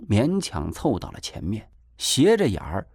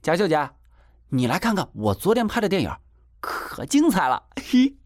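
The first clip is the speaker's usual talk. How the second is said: put-on high, quick, pleased boy's showing-off; proud boasting; 7 semitones higher than usual. A narrator's reading. Boy shows sister his filmed movie, proud boasting. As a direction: crow proudly in a raised voice. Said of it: "他突然得意地说".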